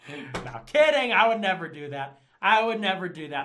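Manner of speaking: loud, mock-earnest backpedal; emphatic disclaimer. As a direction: burst out with a joking retraction. Jokingly disowning what he said.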